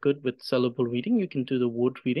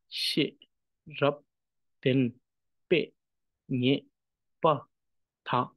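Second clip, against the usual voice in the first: "shi, rap, den, pe, nye, pa, ta" with a pause after each syllable; syllable reading. Shown as a demonstration. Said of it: "pausing after each".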